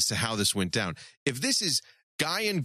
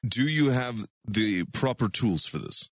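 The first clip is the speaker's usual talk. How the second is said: put-on Russian accent. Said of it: "I can't keep the Russian accent".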